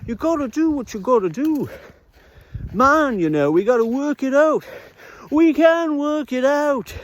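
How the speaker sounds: lightly imitating a sixties musician. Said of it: "[name] told me that once".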